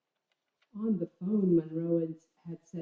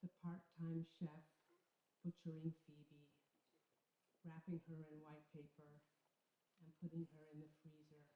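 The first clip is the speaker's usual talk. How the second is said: much softer, hushed narration of a grim scene; hushed imagining; 3 semitones lower, 23 dB quieter than usual. A narrator's reading. Narrator picturing the three a.m. restaurant, hushed. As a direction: drop to a near hush, as if picturing it.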